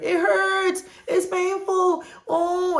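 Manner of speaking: hammed-up child wailing that it hurts; exaggerating hurt child. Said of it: "a child gets hurt and they're crying".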